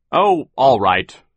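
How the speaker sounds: slow, loud, grudging grumble; grumpy. A narrator's reading. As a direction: grudgingly give in, dragging it out. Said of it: "Sir said grumpily".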